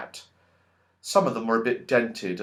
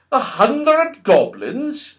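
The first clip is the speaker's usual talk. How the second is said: slow, much higher amazed question; astonished wizard; 13 semitones higher, 7 dB louder, much slower than usual. A narrator's reading. Wizard hearing about the goblins, slow and astonished. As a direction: draw it out in wonder. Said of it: "he said".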